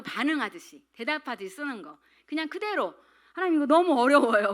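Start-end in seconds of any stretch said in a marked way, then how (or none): 3.3-4.5 higher, much louder exclaimed grumble; loud mock complaint. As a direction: protest out loud to God, hammed-up. Acted complaint to God.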